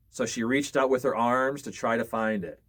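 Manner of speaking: usual speaking voice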